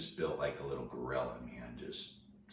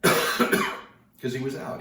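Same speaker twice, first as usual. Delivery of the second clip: ordinary delivery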